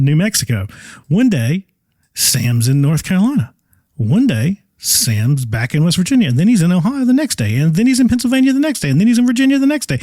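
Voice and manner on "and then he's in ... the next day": rising excitement. Real, not acted. Listing rapid travel.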